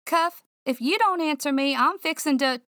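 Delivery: anxious scold; exasperated call. Acted. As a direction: threaten the dog out of worry. Threatening call to dog.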